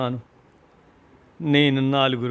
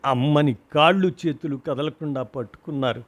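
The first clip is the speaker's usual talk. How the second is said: ordinary delivery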